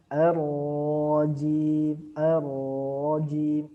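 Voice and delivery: "Ar-rajim" with the r held and trilled, very slow; held rolled r. Shown as a demonstration. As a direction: stretch the r of "ar-rajim" into a long trill. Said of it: "ro-nya tadi masih ditahan dulu sedikit".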